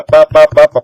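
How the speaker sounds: each "pá" hit loud as a separate beat; syllable-counting beat. Shown as a demonstration. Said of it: "contar a sílaba". ("pá, pá, pá" marcado forte, contando sílabas)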